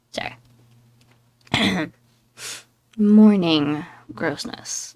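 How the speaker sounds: ordinary delivery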